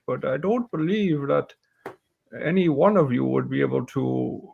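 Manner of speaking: put-on doubtful old warrior sizing up recruits; aging warrior with some drawn-out words; old warrior woman. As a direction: play a seasoned warrior explaining the job. Game master voices Hilin.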